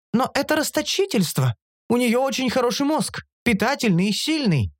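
high-pitched, insistent creature; persistent creature. A narrator's reading. Оша arguing that wasting brains is wrong, persistent. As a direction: press the point in a high voice. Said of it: "продолжила гнуть свою линию Биара".

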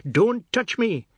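high loud cry, sobbing; bawled with sobs. A narrator's reading. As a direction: bawl it in panic. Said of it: "he bawled, and there were sobs".

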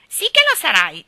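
high, loud mocking child; mimicking echo. A narrator's reading. Geraldine echoes Ivy to tease, mocking high voice. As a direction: parrot it back loud and high. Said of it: "disse Geraldine".

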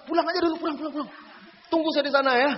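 high, hurried urging to go home at once; urgent shooing. Retold or imitated. Ustaz telling his students to go home, high and urgent. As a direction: shoo the kids away fast.

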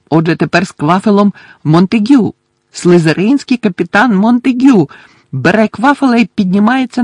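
lively play-by-play climbing to a high cheer; partisan match commentator. Acted. Commentator roots for Gryffindor as the captain attacks, excited.

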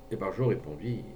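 usual speaking voice

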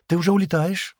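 hurried, high-pitched asking; quick question. A narrator's reading. Шира asks Стэн as he climbs aboard, quickly. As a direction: get the question out fast. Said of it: "быстро спросила Шира".

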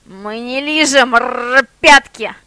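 indignant growl rising to a loud bark; slow then loud growl on пятки. A narrator's reading. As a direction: snarl with a rolled rr. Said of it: "зарычал шеф".

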